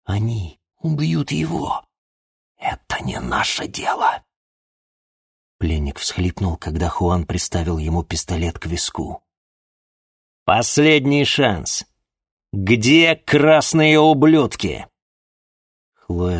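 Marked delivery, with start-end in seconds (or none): 0.0-4.2 whisper. Acted. Hiding hero pleads with Chloe. 10.5-14.9 slow, loud, high menace; menacing threat. Acted. Juan threatens the prisoner.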